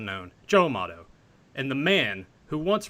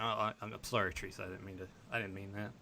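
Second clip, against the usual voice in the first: much softer, lower apologetic murmur; mock sheepish apology; 5 semitones lower, 12 dB quieter, faster than usual. [name] imagined apologizing to Teresa, soft sheepish voice. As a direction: apologize meekly, shrinking away. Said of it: "he's like".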